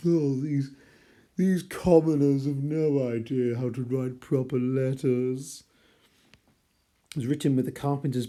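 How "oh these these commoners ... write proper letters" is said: a hint of a sniffy posh sneer; mock snob. Teacher mocks the author's view of commoners, in a mild snobbish sneer. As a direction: lightly send up a snob looking down on commoners. Mocking Victorian middle class snobbery.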